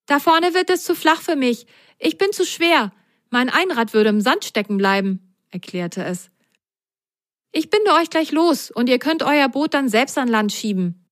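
put-on high, eager child voice full of questions; high curious kid voice; whale child voice.

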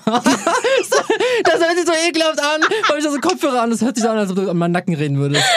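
high laughing disgust. Real, not acted. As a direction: shriek it through laughter.

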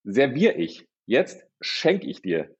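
very slow, grand offering; life speaking, generous. Retold or imitated. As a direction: hand out gifts like a benevolent host. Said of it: "das Leben sagt jetzt". (sehr langsam, großzügig gesprochen)

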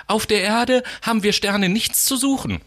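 scolding stars. Acted. Stars scold the little star, high and scolding. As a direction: tell the little star off sharply. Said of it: "die anderen Sterne schimpften".

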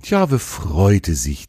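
ordinary delivery, nothing marked

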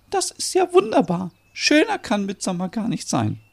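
very high, joyful voice; delighted mother. Acted. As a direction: rejoice at happy news, pitched high. Merit delighted by baby news.

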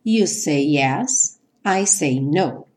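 "You say yes" with the two Y sounds run together as one; linked Ys. Shown as a demonstration. Teacher showing students how to link the two Ys, slow and smooth. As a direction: glide straight from "say" into "yes" without a break. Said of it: "ligar esses dois Ys, como se fosse um só".

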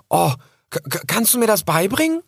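high, eager little fox; excited. Acted. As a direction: ask eagerly, stumbling. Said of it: "fragte Rix aufgeregt".

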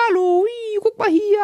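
put-on high sing-song baby voice; very high cooing at a newborn; baby talk. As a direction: coo up high to catch the baby's eye. Cooing at baby who ignores him.

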